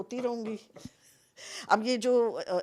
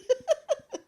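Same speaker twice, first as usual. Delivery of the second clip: high laugh; 3 dB louder than usual. Real, not acted. Laughs at own story.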